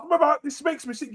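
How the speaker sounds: fired-up rant shout. Real, not acted. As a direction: yell it, disgusted. Shouting outrage.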